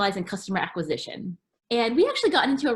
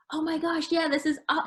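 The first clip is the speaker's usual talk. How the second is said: gushing excitement; 7 semitones higher than usual. Retold or imitated. Retelling her own gushing reply.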